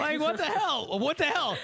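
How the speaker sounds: high, laughing mock outrage; high laughing what the hell. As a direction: act scandalised through laughter. Mock outrage at bell player.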